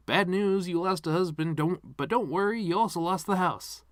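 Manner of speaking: high, loud sing-song delivery of bad news; mock cheery bad-news voice. Mocking how a cheerful announcer tells a widow bad news. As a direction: chirp it like a game show host breaking bad news.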